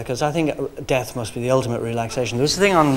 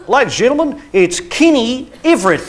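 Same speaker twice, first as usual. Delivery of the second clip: higher, grand announcer voice of the host; TV host intro; 8 semitones higher, 4 dB louder than usual. Retold or imitated. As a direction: announce him like a showman.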